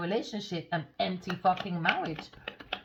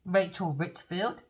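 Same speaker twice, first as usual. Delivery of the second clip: ordinary talk, nothing marked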